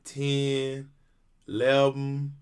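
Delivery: slow, drawn-out counting impression; girl who counts. Imitating a viral girl counting, slow drawn-out numbers. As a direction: stretch out each number, leaving gaps between. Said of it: "the girl who counts? She goes".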